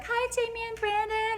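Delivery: quick, high sing-out hello; chirpy coworker greeting. Acted. Coworkers driving by on Gators call out, chirpy.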